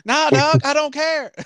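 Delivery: loud, high-pitched comic protest; mock outraged rejection. Host rejects lovesick country lyrics, mock outraged rejection. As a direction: cut the singer off with a playful shout.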